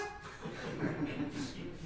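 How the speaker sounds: laugh. Real, not acted. Laugh after mock outrage.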